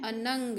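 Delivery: अनंग with a clear nasal anusvara on न; careful anusvara. Shown as a demonstration. Teacher to children, clear nasal अनंग. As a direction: say अनंग slowly, nasal न then ग. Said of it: "अनुस्वार का ध्यान रखना है".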